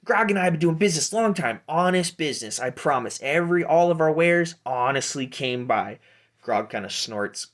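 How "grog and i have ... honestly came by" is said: higher, quick halfling hustler; Pete. Acted. Halfling introducing his business partner, hustler's patter. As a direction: rattle it off in a raised peddler voice. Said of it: "And Pete goes".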